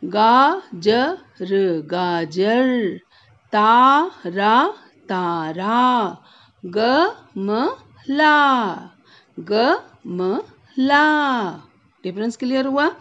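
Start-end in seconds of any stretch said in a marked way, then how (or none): 0.0-2.7 गाजर with the आ held long, loud; slow गाजर; stretched aa. Shown as a demonstration. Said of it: "खींच के बोलना है". 3.5-5.8 तारा with both आ pulled long; slow तारा; stretched aa. Shown as a demonstration. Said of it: "खींच के बोलना है". 6.6-11.0 गमला very slow with the final आ long; stretched aa. Shown as a demonstration. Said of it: "खींच के बोलना है".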